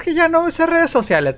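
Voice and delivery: high whiny imitation of a lazy friend; mocking Chuy. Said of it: "luego agarra sus ondas de que".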